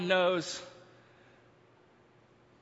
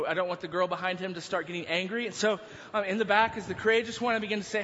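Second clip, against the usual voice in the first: ordinary delivery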